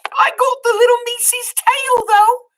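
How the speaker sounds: put-on high, loud dim girl voice; Meg's voice. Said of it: "said Meg".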